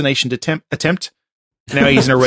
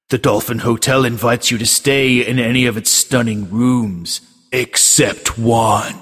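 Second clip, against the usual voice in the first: slow, ominous trailer read; movie trailer voice; 3 semitones lower, slower than usual. Acted. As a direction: build to a creepy payoff. Reads tagline as trailer.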